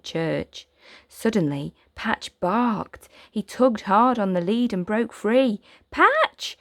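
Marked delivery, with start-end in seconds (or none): 5.9-6.6 high, loud call. Acted. Boy calling his dog as it runs off. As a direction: shout after the runaway dog. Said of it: "Oliver called".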